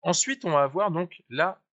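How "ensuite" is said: ordinary talk, nothing marked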